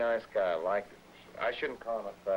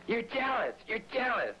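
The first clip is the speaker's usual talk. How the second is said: high mock accusing voice; shrill jealous accusation; mimicking Linda; 14 semitones higher than usual.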